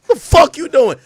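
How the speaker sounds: mock mom yelling high and loud; mother yelling at son with a gun, furious; angry mom. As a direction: scold him like a furious Jamaican mother. Mother scolding him in a story.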